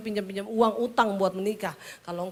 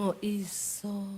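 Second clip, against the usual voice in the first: much softer, lower; soft husband; 3 semitones lower, 10 dB quieter, slower than usual. Retold or imitated. Husband reassuring wife, soft low. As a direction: murmur it gently.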